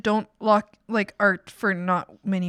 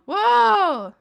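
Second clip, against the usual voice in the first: amazed gasp; 9 semitones higher, 6 dB louder than usual. Real, not acted. Streamer sees a chatter's art and gasps, amazed whoa. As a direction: gasp then a high loud whoa.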